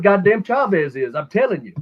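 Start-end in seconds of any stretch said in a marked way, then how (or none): none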